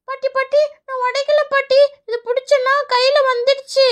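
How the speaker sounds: put-on high, insistent childlike protest; pleading child. Child tells grandmother she didn't break it, pleading. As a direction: plead innocence in a high little voice.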